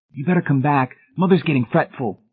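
anxious. A narrator's reading. Boy in the doorway calling her back to Mother, anxious. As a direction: read it as a worried boy, pitched well up. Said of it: "appeared anxiously in the doorway".

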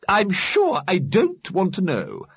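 high, slow, prim refusal; prim disapproval. A narrator's reading. Missus Liberty cuts off William Stickers, primly disapproving.

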